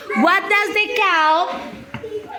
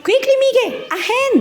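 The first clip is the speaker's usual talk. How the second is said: higher, laughing, urging him on; playful hurry; 6 semitones higher, much faster than usual. Real, not acted. Teacher hurries a child, laughing.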